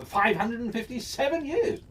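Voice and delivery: plain reading voice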